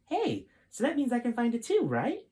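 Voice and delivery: put-on high, bright young boy asking eagerly; eager kid voice. Kid Jason eager question.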